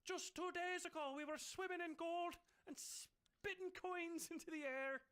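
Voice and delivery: a miser reminiscing about swimming in gold; Scrooge McDuck. Retold or imitated. Host as the cartoon duck recalling the gold. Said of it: "I'm supposed to be Scottish, aren't I?".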